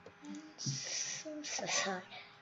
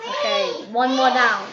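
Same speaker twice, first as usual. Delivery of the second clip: watching the last players fall, very high excited shrieks; frantic yelling; 5 semitones higher, 16 dB louder than usual. Real, not acted. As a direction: shriek each word at the game. Gameplay yelling.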